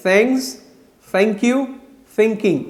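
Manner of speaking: "thanks", "thank you", "thinking" with a careful th between s and t; slow careful model words; proper th pronunciation. Shown as a demonstration. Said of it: "ये proper pronunciation है".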